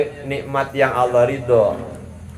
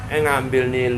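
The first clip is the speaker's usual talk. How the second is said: ordinary delivery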